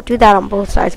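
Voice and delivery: usual speaking voice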